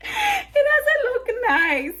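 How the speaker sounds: high, slow whine through a laugh; half-laughing, half-crying at herself; drawn-out giggly complaint. Real, not acted. Embarrassed showing her unwashed hair.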